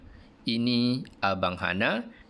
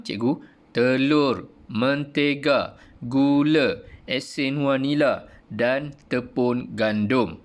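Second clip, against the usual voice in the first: slow list, each item marked before its comma; comma intonation; slower than usual. Shown as a demonstration. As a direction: stress "telur", "mentega", "gula" one by one. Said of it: "intonasi tanda koma". (intonasi tanda koma dalam senarai)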